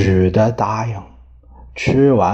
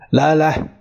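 hearty invitation; 5 semitones higher than usual. A narrator's reading. King warmly invites the generals.

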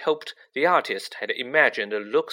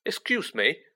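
sharp; 5 semitones higher than usual. Acted. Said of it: "said Ron sharply".

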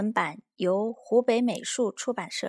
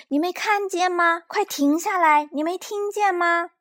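high, loud, desperate demands; frantic yelling; 10 semitones higher, 6 dB louder than usual. A narrator's reading. Child begging the monster to stop wrecking things, frantic yelling. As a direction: shout urgently to make it stop. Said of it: "萝卜这可急坏了".

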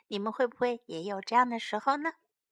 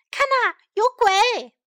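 high, loud shout of alarm; startled cry; 9 semitones higher, 6 dB louder than usual. A narrator's reading. Sam warns of a ghost, startled cry. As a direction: cry out as if spooked. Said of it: "山姆惊叫了起来".

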